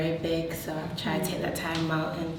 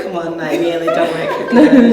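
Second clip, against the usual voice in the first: laughing mock quote; 14 dB louder than usual. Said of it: "residents are just like".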